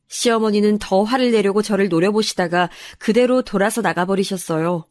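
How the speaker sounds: ordinary delivery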